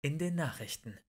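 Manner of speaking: usual speaking voice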